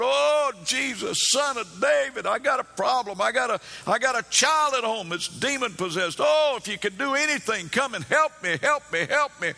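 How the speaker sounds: high, loud mock-desperate plea; imitated crying out. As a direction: act out a woman begging loudly for help. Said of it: "She's crying out".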